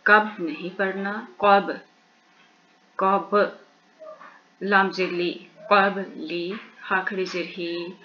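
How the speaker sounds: ordinary delivery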